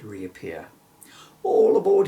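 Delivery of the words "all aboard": loud cheerful call out; cry. Acted. Bill calling Emily onto the carpet, loud call. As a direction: call everyone on board. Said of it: "he cried".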